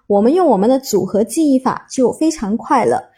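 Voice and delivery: ordinary delivery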